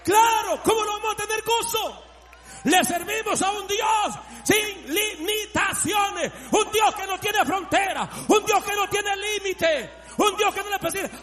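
high, shouted proclamation; full-force preaching. Real, not acted. Preacher proclaiming a God without limits, full-force preaching. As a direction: shout it with driving conviction.

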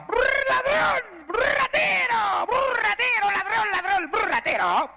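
very high slow parrot squawk; shrill screeching parrot calling thief. Acted. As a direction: squawk it like a parrot shrieking insults. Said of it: "el lorito le gritaba desde arriba".

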